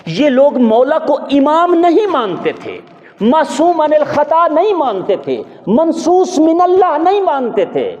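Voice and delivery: high, drawn-out sermon oratory; impassioned preaching. Real, not acted. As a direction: ring out each clause high, stretching the words. Preaches at full force.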